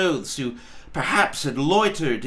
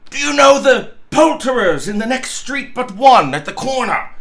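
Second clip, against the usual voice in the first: Scrooge shouting, higher and louder; calling down; 6 semitones higher, 6 dB louder than usual. Acted. Scrooge to the boy below, shouted question. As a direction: call the question down. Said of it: "Scrooge inquired".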